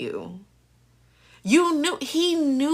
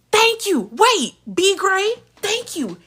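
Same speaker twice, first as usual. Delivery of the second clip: emphatic thanks; 9 semitones higher than usual. Real, not acted. Reacting to a show, sarcastic thanks. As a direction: thank them pointedly.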